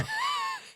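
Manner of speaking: high shrieking laugh. Real, not acted. Big laugh at the story.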